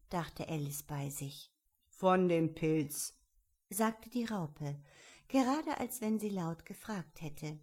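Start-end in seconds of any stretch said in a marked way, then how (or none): 1.9-3.1 slow, louder caterpillar voice; deliberate creature pronouncement; answering caterpillar. A narrator's reading. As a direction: spell it out slowly as the caterpillar. Caterpillar answers Alice's thought.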